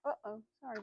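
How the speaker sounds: ordinary talk, nothing marked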